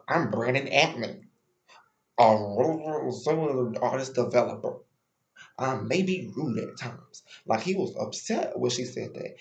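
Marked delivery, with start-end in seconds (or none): 0.1-7.2 slow, drawn-out imitation of an offended man; mock his self-important introduction, stretching the words; mock attitude. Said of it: "he caught an attitude immediately".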